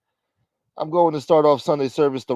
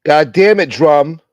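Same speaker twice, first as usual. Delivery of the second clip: loud mock outrage; 5 semitones higher, 11 dB louder, slower than usual.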